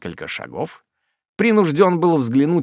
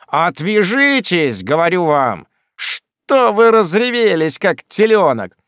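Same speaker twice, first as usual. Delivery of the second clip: high, slow, loud shove-off; irritated cry; 5 semitones higher, 5 dB louder than usual. A narrator's reading. Sam, annoyed, fights off Job's embrace with a loud slow cry. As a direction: push him away in exasperation. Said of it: "раздражённый Самуэль".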